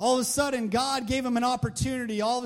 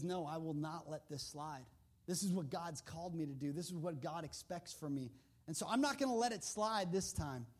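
quiet firm refusal; 6 semitones lower, 9 dB quieter than usual. Retold or imitated. Daniel refuses firmly.

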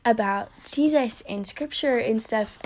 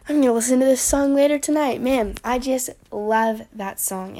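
ordinary talk, nothing marked

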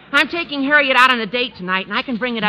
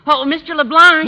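calling out; 4 semitones higher, 8 dB louder than usual. Acted. Walter calls teacher over.

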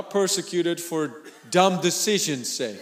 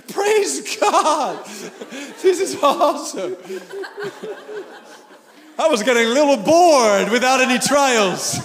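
higher, laughing praise; mock delight, laughing; 5 semitones higher than usual. Preacher playing a believer thrilled by trials, laughing. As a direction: chuckle it out as a playful joke. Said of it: "It's like".

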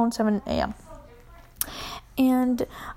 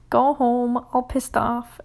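ordinary delivery

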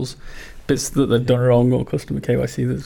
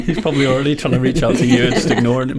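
laughing; 4 dB louder than usual. Real, not acted. Group cracking up at joke.

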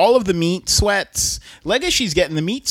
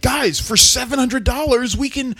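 higher-pitched excited pitch to the guys; rally your friends excitedly; retold eager proposal; 7 semitones higher than usual. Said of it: "Bill is like".

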